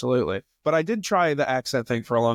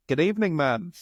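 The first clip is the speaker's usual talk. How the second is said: rehearsed Australian greeting, pitched up; fake Australian accent; 4 semitones higher than usual. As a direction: greet her as a put-on Australian. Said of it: "a rehearsed thing that I'd be like".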